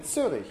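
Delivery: Zurich begun with a crisp ts sound; German pronunciation. Shown as a demonstration. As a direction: say Zurich the German way, starting on t plus s. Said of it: "don't have the combination".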